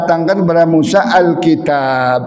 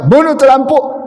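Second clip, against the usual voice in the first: high raised "Bunuh itu lampu" from a new bride; new wife's dialect order; 7 semitones higher than usual. Retold or imitated. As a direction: say "Bunuh" high, regional lilt. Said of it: "baru-baru dia nikah sama kita". (logat daerah istri baru, nada tinggi)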